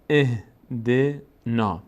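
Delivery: "اهدنا" begun on a short i/e, syllable by syllable; kasra start. Shown as a demonstration. Teacher shows starting the verse, "اهدنا" slowly with kasra. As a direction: sound out "اهدنا" very slowly from an opening e.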